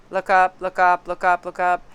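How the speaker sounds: insistent, evenly repeated warning; urgent inner warning. Retold or imitated. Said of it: "in my ear was like".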